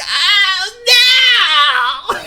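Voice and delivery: high, screamed mock dismay; hammed-up shriek of overreaction; went crazy. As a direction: imitate a friend wailing "oh, no" at full tilt. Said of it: "went crazy".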